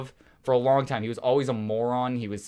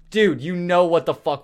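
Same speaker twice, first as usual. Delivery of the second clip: louder, higher retold call-out of a thief; confronting the friend who stole my wallet, louder; retold anger; 6 semitones higher, 7 dB louder than usual. Telling off the wallet thief on the phone, indignant. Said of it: "And I go".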